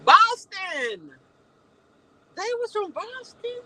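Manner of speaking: loud high exclamation; shocked high cry of realization; surprised realization. Real, not acted. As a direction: blurt it out, caught off guard.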